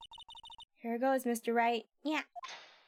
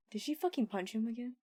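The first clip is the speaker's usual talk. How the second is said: ordinary talk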